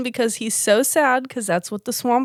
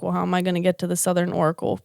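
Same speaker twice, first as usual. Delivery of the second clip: rushed quote; 4 semitones lower, 3 dB quieter, much faster than usual. Retold or imitated. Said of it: "And he's like".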